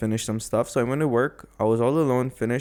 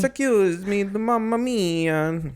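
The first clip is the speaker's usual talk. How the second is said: high, drawn-out cartoon Italian accent; mock Italian accent; 8 semitones higher, much slower than usual. Storyteller mimics the stranger asking for help, mock Italian accent. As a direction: ham up an Italian accent, sing-song and slow. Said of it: "Italian accent, of course".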